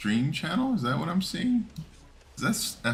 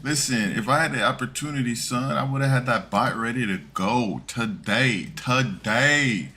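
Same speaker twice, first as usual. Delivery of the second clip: hammed-up mid-nineties New York street talk, higher and louder; mock New York accent; 4 semitones higher, 5 dB louder than usual. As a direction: swagger it like a nineties New Yorker. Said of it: "like, New Yorkers mid nineties".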